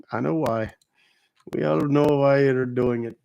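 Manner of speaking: put-on Indian accent; mock Indian-accented voice. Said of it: "I did an Indian voice".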